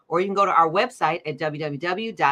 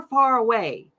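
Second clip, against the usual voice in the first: usual speaking voice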